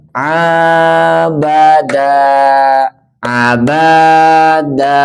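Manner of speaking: slow, loud Abada with the long da; slow recitation. Shown as a demonstration. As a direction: stretch the da in Abada. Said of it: "Coba kita baca ya". (membaca Abada pelan dan panjang)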